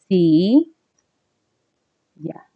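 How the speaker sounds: "Sí. Ya" as two syllables with a long pause between; separated into syllables. Shown as a demonstration. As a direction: stretch "Sí", pause, then say "Ya" alone. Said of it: "ya están separadas en sílabas".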